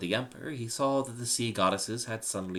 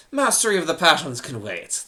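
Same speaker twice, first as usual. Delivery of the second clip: louder, higher sharp rebuke; rebuking; 7 semitones higher, 12 dB louder than usual. Acted. Emperor rebukes his philosopher slave, loud and sharp. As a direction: shut him down with a loud reproach. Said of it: "the Emperor rebuked".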